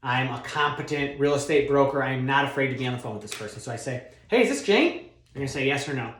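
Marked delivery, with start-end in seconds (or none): 4.3-5.1 bright, higher confident greeting; confident cold-call hello; loud, proud, confident. Shown as a demonstration. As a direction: sound like a competent broker, not afraid. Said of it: "I'm talking loud, proud, confidently".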